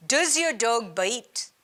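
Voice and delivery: put-on slow French-accented Clouseau, done with an Indian flavour; Indian take on French accent. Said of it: "my Indian version of a French accent".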